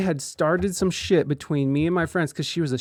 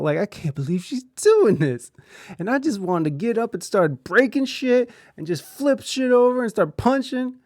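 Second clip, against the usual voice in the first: mock angry thoughts; 5 semitones higher than usual. Voicing Robin's thoughts.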